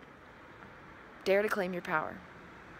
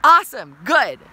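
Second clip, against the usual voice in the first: loud, excited encouragement; loud praise; 3 semitones higher, 15 dB louder than usual. Real, not acted. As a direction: cheer them on. Praising after the shout.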